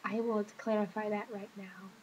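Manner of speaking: ordinary delivery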